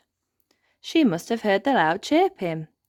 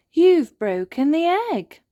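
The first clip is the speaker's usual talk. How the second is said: shocked gasp; 11 semitones higher, 6 dB louder than usual. Acted. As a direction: gasp as if the worst just happened. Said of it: "gasps Holly".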